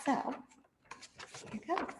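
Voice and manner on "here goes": plain reading voice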